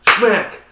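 loud, high smack; mock smack sound. Reliving the slap video, a loud high smack. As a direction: shout it like the hit itself.

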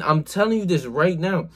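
ordinary talk

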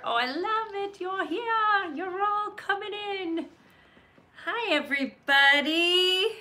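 high, delighted squeal of a greeting; excited welcome. Real, not acted. Host greeting viewers as they join, high and delighted. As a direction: gush over everyone arriving.

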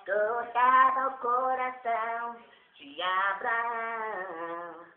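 singing with a helium-squeaky voice. Shown as a demonstration. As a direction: sing it after a breath of helium. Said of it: "inalar esse ar aqui, é, sua voz fica fina". (voz de gás hélio)

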